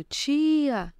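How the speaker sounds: high-pitched mock call of a small child; niece calling out. Small niece calling her aunt from the door, high mock call. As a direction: call out like the little niece from afar. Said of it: "lá no fundo gritando".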